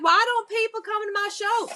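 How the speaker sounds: teasing high-voiced Southern mimicry. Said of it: "People are like".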